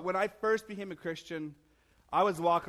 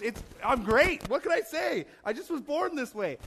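high, playful boasting; lifted, hammed-up conceit; mock self-admiration; 7 semitones higher, 3 dB louder than usual. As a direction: brag with comic false helplessness. Acting out cocky self-admiration.

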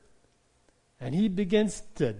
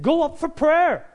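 louder and slower, pressing each word; emphatic urging; 9 semitones higher, 9 dB louder than usual. Retold or imitated. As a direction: urge them hard to go up. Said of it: "I said".

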